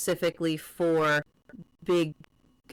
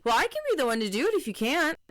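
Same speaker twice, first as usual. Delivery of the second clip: high, quick, needling quoted challenge; imitated goading offer, pitched well up; pointed mock offer; 7 semitones higher, much faster than usual. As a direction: toss it out fast and high as a dare. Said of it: "It's the tone".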